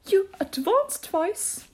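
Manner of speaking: high, slow creature voice; high turtle giving instructions; Mock Turtle. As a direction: draw out the dance step in a high voice. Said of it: "the Mock Turtle said".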